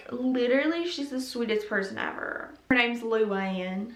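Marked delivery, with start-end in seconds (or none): none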